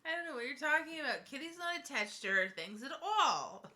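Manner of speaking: ordinary delivery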